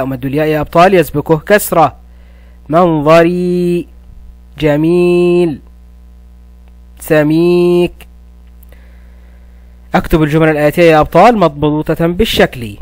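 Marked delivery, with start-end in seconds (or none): 2.7-8.1 منظري، جميل، سميك with the long i stretched slowly; long vowels drawn out. Shown as a demonstration. Teacher stretches ya madd words for pupils. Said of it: "كلمات تحوي مدا بالياء".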